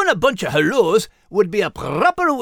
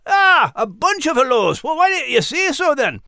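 loud, pitched-up Scottish burst of understanding; excited realization; 6 semitones higher, 3 dB louder than usual. Acted. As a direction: shout out that he finally gets it. Max finally gets it.

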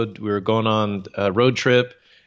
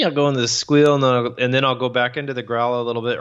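ordinary delivery

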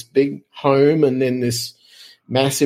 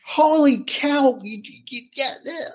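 much higher, slow, stunned wife voice; wife's amazement; 12 semitones higher than usual. Retold or imitated. Wife staring at her husband, a slow high stunned holy cow. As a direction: play the wife, drawn out and astonished.

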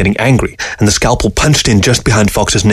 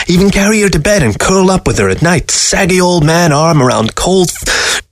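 high, needling fox voice; taunting fox; 10 semitones higher than usual. Acted. Fox taunting the widower about his wife, high and cruel. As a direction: goad the lonely old man mercilessly.

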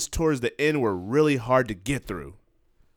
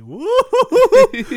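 high, slow, whooping laugh sound; gleeful hoot; 11 dB louder, much slower than usual. Retold or imitated. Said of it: "I'm like".

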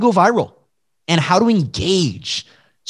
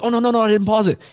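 mock panicked viewer; 8 semitones higher, much faster than usual. Acts out viewer missing the screenshot.

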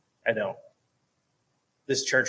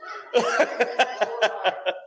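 high, rapid chuckling; real hearty laugh; 11 semitones higher, 4 dB louder than usual. Real, not acted. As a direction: burst into a run of high laughter. Laughs while giving website directions.

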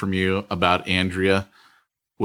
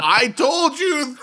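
teasing shout; slower than usual. Real, not acted. Friend teasing about spoiled movie.

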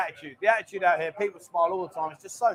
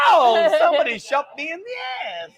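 mock pained yelp; 6 semitones higher, 4 dB louder than usual. Mock being shot, joking.

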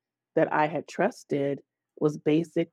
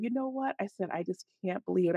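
much softer, almost under the breath; quiet, defeated after the outburst; quiet resignation; 4 semitones higher, 8 dB quieter, faster than usual. Retold or imitated. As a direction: drop the volume as if giving up.

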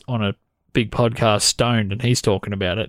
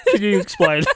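higher, laughing through the question; real laughter; 7 semitones higher than usual. Real, not acted. Host asks co-host to explain the eyeball signal, laughing. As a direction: struggle to ask it while cracking up.

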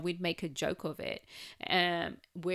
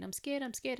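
higher, mildly imitated frightened child; a touch of a niece's fear; scared; 7 semitones higher, 3 dB quieter than usual. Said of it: "she was just really scared".

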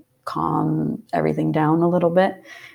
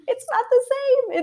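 laughing. Retold or imitated. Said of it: "that was when I was like".

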